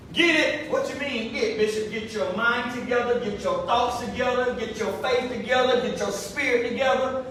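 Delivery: usual speaking voice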